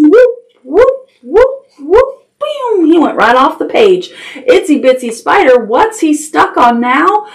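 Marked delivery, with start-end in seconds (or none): 0.0-2.9 high rising whoops; flying whoosh. Acted. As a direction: swoop the voice up each time. Spider flies off the page.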